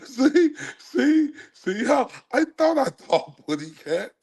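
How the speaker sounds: high cartoon bird voice breaking into laughs; high lisping bird voice, giggling through it; laughing Tweety voice. Repeats Tweety line laughing.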